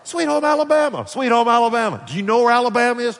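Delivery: high, loud mimicked enthusiasm; imitating excited locals. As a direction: parrot the catchphrase in a high, loud voice. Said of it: "That everybody".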